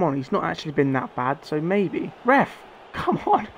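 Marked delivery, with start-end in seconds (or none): 2.2-3.5 yelled way up high at the ref; real exasperated shout at the referee; outraged protest. Real, not acted. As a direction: protest the foul at full pitch.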